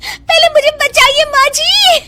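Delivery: desperate plea. Acted. Sari on fire, begging.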